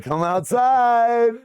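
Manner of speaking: high, slow drawn-out call; gangster calling out. Retold or imitated. Gangsters luring the DJs out, high slow call. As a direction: stretch it out like a threat.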